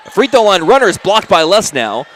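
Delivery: sudden jump in energy on the block; excited. Real, not acted. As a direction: raise it up for the big play. Sudden block during live call.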